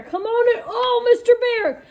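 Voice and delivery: high, sweet welcome to the bear; welcoming the bear. Acted.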